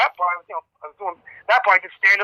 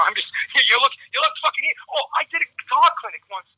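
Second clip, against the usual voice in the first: normal talking voice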